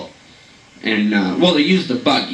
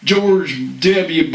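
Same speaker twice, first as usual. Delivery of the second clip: normal talking voice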